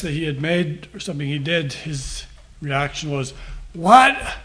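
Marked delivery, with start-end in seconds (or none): 3.7-4.4 sharp high loud "What?" in disbelief; a caught child acting shocked; incredulous what. Grandfather imitating his grandson's incredulous reaction, a loud high "What?".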